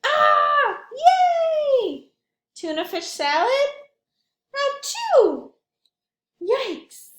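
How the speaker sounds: high, loud cartoon cries; high excited exclamations. Sam and Carrie react to spiders, kissing and ice cream, high excited cries. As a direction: react big like a little kid.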